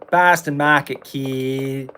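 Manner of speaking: put-on light Boston accent, r dropped in Mahket. Gamer riffing in a Boston accent. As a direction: say Boston Market the Boston way.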